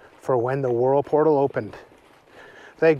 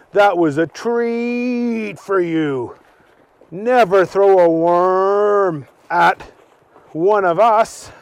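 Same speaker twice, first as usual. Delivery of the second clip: slow, higher, louder cow voice; lecturing cow; 4 semitones higher, 3 dB louder, slower than usual. Acted. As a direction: spell the rule out slowly.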